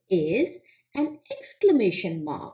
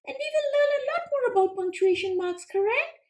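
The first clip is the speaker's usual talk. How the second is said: high, quick little frog puppet, eager to answer; squeaky-high puppet frog pleased with itself; Froggy puppet voice; faster than usual. Acted. Frog puppet answering the teacher, high and eager.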